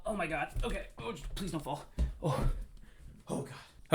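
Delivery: scared of falling, breathless; wobbling on a chair, afraid; nervous. Real, not acted.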